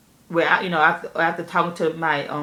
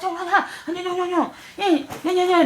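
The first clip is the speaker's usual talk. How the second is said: excited crowd; 10 semitones higher than usual. Retold or imitated. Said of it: "They said".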